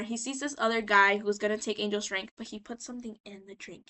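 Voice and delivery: normal talking voice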